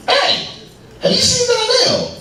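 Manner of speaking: very high, squeaky crackhead calling out. Retold or imitated. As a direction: pitch it way up like a frantic crackhead. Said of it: "He goes".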